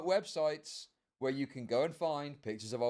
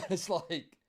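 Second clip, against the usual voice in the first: ordinary delivery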